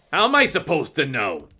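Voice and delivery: mildly defiant comeback; a little defiant. A narrator's reading. Rennie looking up at Gaia, a little defiant. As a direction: push back with a touch of defiance. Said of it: "a little defiantly".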